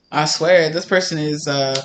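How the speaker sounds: ordinary talk, nothing marked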